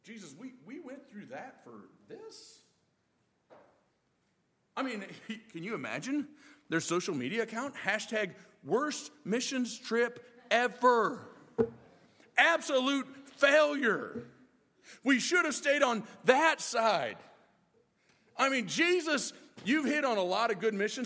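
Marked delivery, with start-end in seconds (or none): 0.0-2.6 softer, let-down muttering; deflated disbelief. Retold or imitated. Disciples grumbling to Jesus after the trip, softer and deflated. 7.7-17.2 slow, louder sarcastic grumbling; mock complaint. Disciples posting about the failed mission trip, mock-sarcastic and slow.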